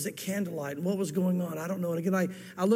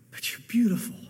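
whispering. Acted. Husband whispers to bride.

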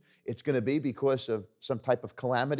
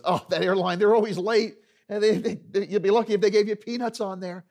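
lightly acted griping, higher and louder; discouraging barber; 6 semitones higher, 5 dB louder than usual. Barber scoffing at the salesman's airline, mildly hammed-up discouragement. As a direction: play a barber running down the airline. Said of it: "known around town as the discouraging barber".

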